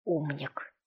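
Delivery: low, soft muttered word; muttered under breath. A narrator's reading. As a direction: mutter it to yourself. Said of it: "сказал он под нос".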